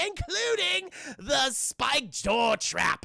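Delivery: high, slow gloating villain; hammed-up villain announcement; Joker. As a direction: draw it out with theatrical glee. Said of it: "He's like".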